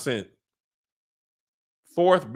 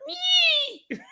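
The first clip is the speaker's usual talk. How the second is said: a very high, thin squeal like a dragon's cry; dragon screech. Retold or imitated. Dragon Caraxes answering Daemon with a very high squeal. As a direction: squeal it out shrill and tiny like Caraxes. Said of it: "Caraxes was like".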